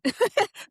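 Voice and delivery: loud, high laugh; short burst of high laughter. Real, not acted. Guest amused while pitching her offer, laughing.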